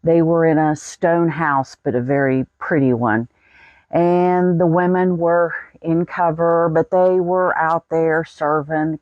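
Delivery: normal talking voice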